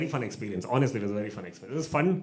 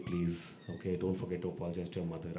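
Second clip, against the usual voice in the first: low, soft imitated pleading; baritone plea; 6 semitones lower, 7 dB quieter than usual. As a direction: imitate a deep, hushed official asking a favour. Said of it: "he gets his baritone voice out".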